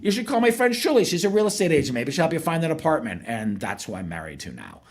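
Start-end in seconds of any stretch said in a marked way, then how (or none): 0.0-3.2 lightly voiced friend, higher and fast; a chirpy friend recommending a real estate agent, higher; imitating mutual friend. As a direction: mutual friend suggests calling Shuli, mildly higher voice. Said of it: "a mutual friend said".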